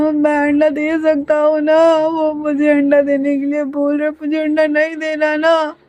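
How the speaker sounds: teary, drawn-out whine; half-sobbing plea of a scared young man; crying. A narrator's reading. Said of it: "अब वो रो रहा होता है". (रोते हुए, धीमी रुआँसी आवाज़ में)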